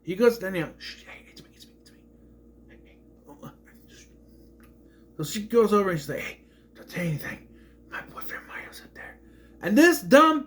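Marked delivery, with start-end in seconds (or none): 0.4-4.0 hushed, urgent stage whisper; acting out a secret call; sneaky whisper. Acting Mario sneaking, hushing Danielle. 6.2-9.2 hushed mock warning; imitating a conspiratorial whisper; secretive whisper.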